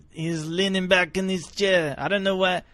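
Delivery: hammed-up Frenchman boasting about himself; thick French accent. Jean-Paul introduces himself in third person, light French accent. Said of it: "It's a, it's a good accent".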